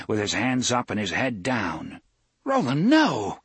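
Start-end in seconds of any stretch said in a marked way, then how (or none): 2.4-3.4 a higher, louder cry of protest. A narrator's reading. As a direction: call out to stop your friend. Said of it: "Cuthbert cried".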